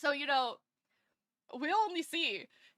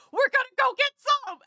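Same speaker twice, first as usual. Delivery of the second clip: much higher, louder gleeful announcement; excited dash; 12 semitones higher, 11 dB louder than usual. Retold or imitated. Friends hearing of a Red Bull refill, gleeful shout. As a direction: shout it like kids racing off. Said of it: "looked at each other and went".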